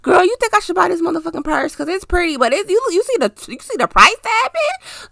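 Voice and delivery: put-on high, gushing girlfriend voice asking the group chat; mock gossip to the girls, pitched high and teasing. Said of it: "Ask your group chat".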